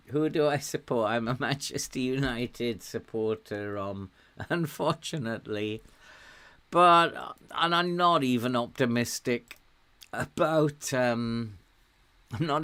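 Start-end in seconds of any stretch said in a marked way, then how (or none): none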